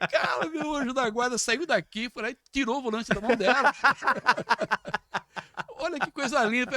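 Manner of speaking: high, loud laughing delivery. Real, not acted. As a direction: tell it laughing in disbelief. Laughing retelling of guardian angel.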